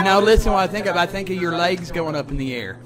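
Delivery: ordinary talk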